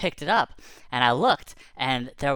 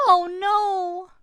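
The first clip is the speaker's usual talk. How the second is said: high, loud squeaky dismay; a tiny rabbit yelping in alarm; rabbit cry; 6 dB louder than usual. Acted. Rabbit discovering the crash, high loud yelp. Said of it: "then I hear".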